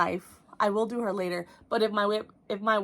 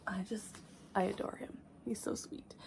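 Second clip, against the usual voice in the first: soft whisper; 14 dB quieter than usual. Real, not acted. Gushing over finished piece.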